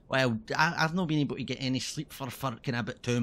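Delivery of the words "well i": normal talking voice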